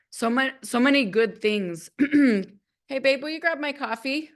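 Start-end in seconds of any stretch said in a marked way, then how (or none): 2.9-4.3 higher-pitched casual request; calling to partner. Real, not acted. Streamer breaks off to ask partner for coffee, calling higher. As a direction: call sweetly for a small favour, pitched up.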